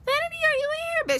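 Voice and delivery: very high searching call, voiced as a church lady. Church lady calling for the hidden kid in a high voice. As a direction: sing out her name way up high like a church lady hunting for a kid. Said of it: "Coming in the bathroom".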